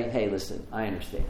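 soft, low confiding voice; quiet reassurance. Acted. Jesus walking over to Bartimaeus, quiet reassurance. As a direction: drop the voice low and soft, as if one-to-one.

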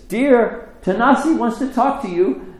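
loud, high call to another room. Retold or imitated. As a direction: imitate a husband calling his wife. Husband calls to wife in kitchen.

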